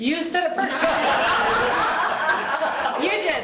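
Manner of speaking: laughing. Real, not acted. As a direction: laugh through the words. Teasing her child, laughing.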